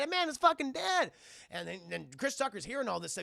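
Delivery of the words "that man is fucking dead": loud, high exclamation; mock alarmed yell. Chris Tucker seeing Zumba Fu dead, mock alarmed yell. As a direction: cry out the news in acted shock.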